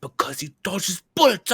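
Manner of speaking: lightly put-on Cockney deadpan; a hint of a Cockney movie geezer; mock Cockney thug. Answering the Bullet Dodger question, in a light mock Cockney. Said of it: "He's like".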